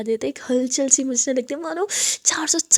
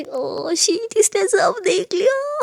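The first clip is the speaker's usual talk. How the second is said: shocked gasp; 8 semitones higher, much slower than usual. Acted. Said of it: "आद्या बोलती".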